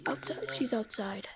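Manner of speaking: hushed whisper, barely voiced. Real, not acted. Whispering to a teammate about who is outside. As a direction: breathe it out so no one hears.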